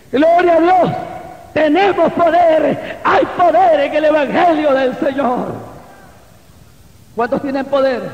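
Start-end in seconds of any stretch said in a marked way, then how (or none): 0.1-5.5 loud, high preaching; prédica a plena voz. Real, not acted. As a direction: proclaim it to the whole church, raised voice. Preaching at full force. (predicando con fuerza, voz alta y aguda)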